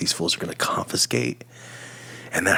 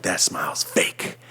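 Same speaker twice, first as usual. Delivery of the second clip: whisper; 3 semitones lower, 5 dB quieter, slower than usual. Retold or imitated. Imagined YouTube staff remark.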